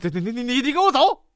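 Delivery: sputtering stammer rising to a high order; doctor breaking down. Acted. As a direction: stammer in exasperation, then burst out. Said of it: "大夫可能已经崩溃了".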